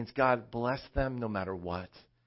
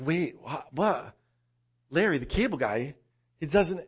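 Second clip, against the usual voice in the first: stammering mock bafflement, rising higher; acted disbelief; 4 semitones higher, 4 dB louder than usual. Viewer learning the comedian's accent is fake, baffled. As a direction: replay a baffled double take.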